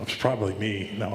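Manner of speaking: ordinary talk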